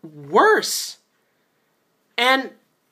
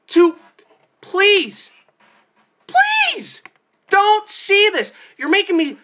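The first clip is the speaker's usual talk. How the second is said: high, desperate begging of the viewers; screaming plea; 8 semitones higher, 3 dB louder, slower than usual. Real, not acted. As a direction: beg them at the top of your voice. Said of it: "screaming at you".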